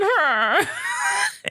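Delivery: high, breathless laughter; cracking up, very high; real laughter bursting out high; high laughing fit. Real, not acted. Laughs after the punchline.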